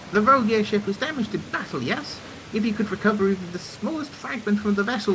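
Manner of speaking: earnest, self-assured engineer; confident engineer character. Acted. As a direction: play an inventor laying out a plan. Voices engineer planning the hunt.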